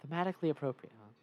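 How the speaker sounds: usual speaking voice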